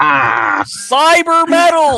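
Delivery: high, hugely drawn-out announcer yell; a hammed-up ring-announcer holler; mock hype shout. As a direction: bellow it like a wrestling intro. Mock hype call-out on stream.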